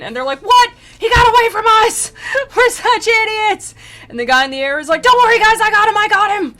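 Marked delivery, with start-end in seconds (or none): 0.4-3.7 very high, loud, drawn-out cry of dismay; mock outraged wail. Acted demons outraged at escape. 5.0-6.5 very high, loud cartoon calling; mock eager shout. Acted flying demon calling to others.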